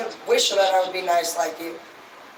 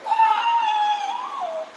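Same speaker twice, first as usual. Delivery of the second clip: sudden high-pitched mock scream; high shriek. The managers shrieking in mock panic, high scream. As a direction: let out a shrill fake shriek. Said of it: "And we're like".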